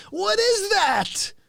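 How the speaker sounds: high, slow baffled question. Acted. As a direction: stretch it out in mock confusion.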